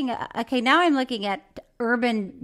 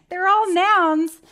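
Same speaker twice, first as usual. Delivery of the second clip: higher, slow through a laugh; laughing triumph; 5 semitones higher, 6 dB louder, slower than usual. Real, not acted. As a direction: gloat while laughing. Laughing triumph over word.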